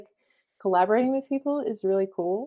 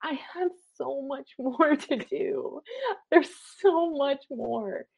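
overwhelmed; 8 semitones higher, 4 dB quieter, slower than usual. Retold or imitated. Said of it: "you're like".

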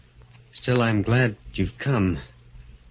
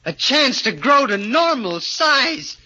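high, rushing, eager small voice; eager little man; 9 semitones higher than usual. Acted. Little man begging the doctor to take him, high and eager. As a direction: grab the chance with both hands.

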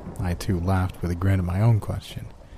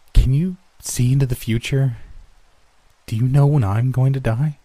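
higher, louder, urgent pleading; 5 semitones higher, 6 dB louder than usual. A narrator's reading. Child begging the reaper about their own death, urgent pleading. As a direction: beg for an answer, voice lifted and pressing. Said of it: "I pleaded".

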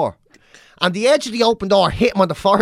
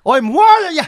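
higher, louder stern warning; warning dad; 9 semitones higher, 5 dB louder than usual. Retold or imitated. Father warning his chanting son from the driver's seat. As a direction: threaten the kid. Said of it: "So he's like".

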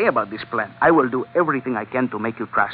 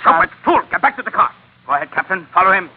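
angry orders; 6 semitones higher, 6 dB louder than usual. Acted. Scolding a drunk failure.

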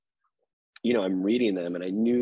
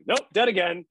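imitating Hamish; 10 semitones higher, 5 dB louder than usual. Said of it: "he's like".

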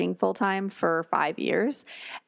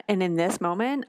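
ordinary delivery

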